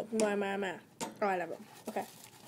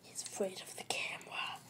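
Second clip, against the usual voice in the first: whisper; 4 semitones lower, 11 dB quieter than usual. Real, not acted. Hamster shy of the camera.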